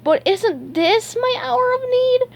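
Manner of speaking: very high, drawn-out whine; pleading high-pitched bewilderment; confused. A narrator's reading. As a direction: ask it in a squeaky, baffled cartoon voice. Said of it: "SpongeBob looked confused".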